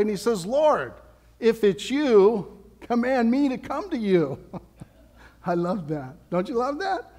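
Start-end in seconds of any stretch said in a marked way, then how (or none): 0.5-4.4 higher, slow, eager plea as Peter. Acted. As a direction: call out to Jesus, stretching each word. Preacher voices Peter calling to Jesus.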